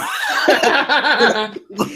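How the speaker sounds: high burst of real laughter. Real, not acted. Laughing at his own Manchester joke, high burst. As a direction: cracks up, pitched high.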